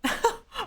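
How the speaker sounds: high giggling cackle. Acted. Laugh in put-on voice.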